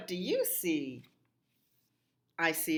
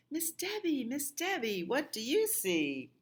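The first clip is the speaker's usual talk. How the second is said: much higher sing-song chant of the refrain; 9 semitones higher than usual. Acted. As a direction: lilt it up high for the children.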